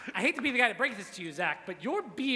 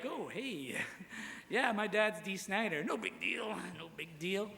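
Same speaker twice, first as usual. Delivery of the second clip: softer, offhand bragging; mock casual name-drop; 3 semitones lower, 7 dB quieter than usual. Name-dropping to someone at a bar, soft mock casual. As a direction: toss it off like it's nothing. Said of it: "conversation went a little bit like".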